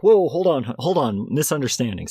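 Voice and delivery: acted hasty peacemaking; urgent intervention. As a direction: jump in to smooth over a misunderstanding. Acted sire rushing to step in.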